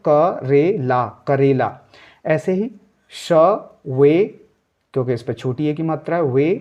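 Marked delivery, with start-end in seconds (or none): 0.1-1.1 slow, क रे ला sounded out separately; syllable by syllable. Shown as a demonstration. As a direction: break क रे ला into syllables, slowly. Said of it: "ला पे मुंह खोलना है". (धीरे-धीरे अक्षर अलग करके)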